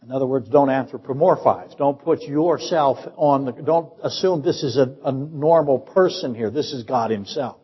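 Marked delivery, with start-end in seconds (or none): none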